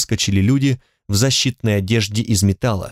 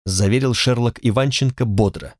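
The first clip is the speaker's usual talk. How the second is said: ordinary delivery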